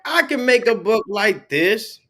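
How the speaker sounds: excited idea. Retold or imitated. Recalled inspiration after reading book.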